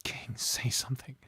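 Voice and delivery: whispered plea; pleading whisper. Real, not acted. Player cradling King, whispered plea. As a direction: whisper it over his body.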